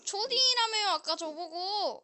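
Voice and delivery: whiny mock complaint; mock whining. Protesting being called a kid.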